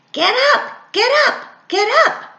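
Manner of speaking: high, loud bird shouting; shrill-free bird call, high and loud, waking someone; shouted. Acted. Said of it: "shouted the red-winged blackbird".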